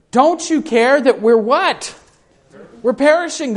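high, urgent plea of frightened men; crying out in fear. Retold or imitated. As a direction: cry for help as the boat sinks. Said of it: "they're crying out".

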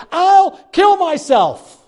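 high, drawn-out desperate threat; acted desperation. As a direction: cry out a last threat to keep her. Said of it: "desperate words I didn't mean".

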